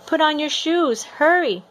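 mother urging her son out of the burning building; urgent yell. Acted. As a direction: hurry him along, half shouting. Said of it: "Mama's screaming at me".

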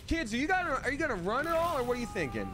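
high, whiny nagging voice; high exasperated voice. Pretend. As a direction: nag them in a high voice. Mocking companions high voice.

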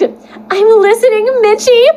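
put-on squeaky high girl voice; high girly voice. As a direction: say it sweet and high.

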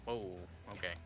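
usual speaking voice

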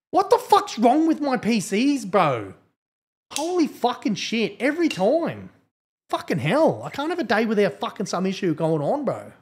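high, loud exasperated rant; frustrated rant. Real, not acted. Streamer rants at his crashing PC, exasperated and loud. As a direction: vent at the broken PC.

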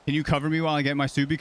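ordinary talk

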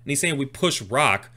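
usual speaking voice

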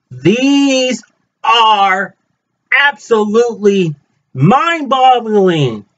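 high and slow, savouring each word; amazed gushing. Real, not acted. Vlogger showing fellow Ozians his finds, high amazed gush. Gushing over the unveiled items.

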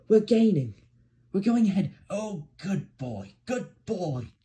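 high cheer, easing into praise; driver's excited shout. A narrator's reading. Driver sees Percy pulling ahead, excited cheering. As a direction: cheer high and loud, then stretch the praise. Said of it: "shouted the driver".

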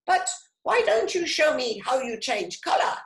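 high, loud, quick squawk; rapid storybook parrot voice. A narrator's reading. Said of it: "squawked Parrot".

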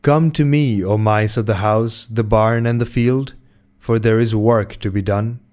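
ordinary delivery, nothing marked

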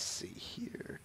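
slow whispered aside; whispering to herself while reading. Real, not acted. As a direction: murmur it without voice, slowly. Scanning for the next question.

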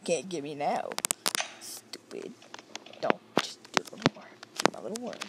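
ordinary talk

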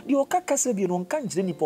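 usual speaking voice

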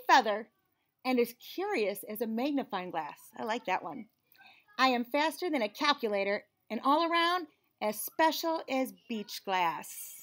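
put-on lively storybook reading in a child's narrating voice; picture book read-aloud. A narrator's reading. Reading a picture book to children, lively and bright. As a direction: read it like the kid telling the story.

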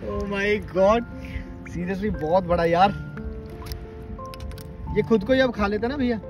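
ordinary talk

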